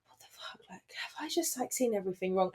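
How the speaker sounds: breathless whisper of disbelief; stunned whisper. Retold or imitated. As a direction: whisper it in shock. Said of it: "At that point there and then I was like".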